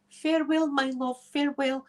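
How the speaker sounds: hammed-up lovelorn parting, pitched high; theatrical farewell. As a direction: call a melodramatic farewell to a departing lover. Acted lovers' parting in the story.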